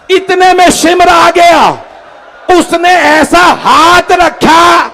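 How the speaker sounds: lamenting preacher, pitch climbing line by line; impassioned mourning sermon. A narrator's reading. Preacher tells mourners of the orphaned girl, lament rising to wail. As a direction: build from firm narration to a high, stretched cry.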